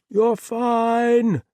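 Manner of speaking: high, loud cheery voice; chirpy villain behind a door; muffled high voice. Acted. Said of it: "Thomas's voice was muffled by the door".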